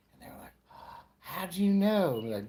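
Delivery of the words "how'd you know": higher-pitched mock amazement; amazed. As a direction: imitate an amazed stranger. Acts out the astonished couple.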